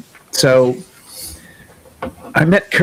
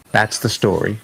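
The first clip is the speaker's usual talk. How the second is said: ordinary talk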